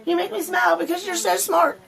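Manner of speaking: high, fast fawning praise voice; mock adoring suck-up voice; gushing admirer. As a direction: gush like a starstruck fan. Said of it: "you gotta be like".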